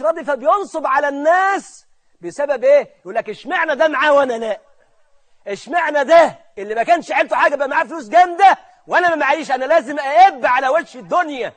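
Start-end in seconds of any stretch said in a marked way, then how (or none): 3.3-11.5 raised, loud grievance; indignant envious complaint. Acted. Discontented poor man comparing himself to the rich, indignant and loud. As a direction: protest the unfairness with voice pushed up.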